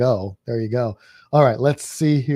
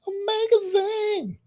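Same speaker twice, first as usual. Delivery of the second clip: drawn-out squeaky falsetto; silly high voice; slower than usual. Pretend. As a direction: stretch it out in a much higher put-on voice. Sorry, I won't do that again.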